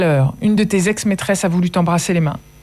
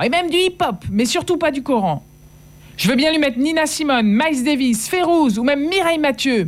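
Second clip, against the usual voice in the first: much higher defiant cry; 11 semitones higher than usual. Acted. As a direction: fight back through the door. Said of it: "je criai à mon tour".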